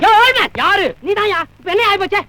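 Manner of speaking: high, indignant quarrelling; angry challenge. Acted. Director confronting actress's father, angry challenge.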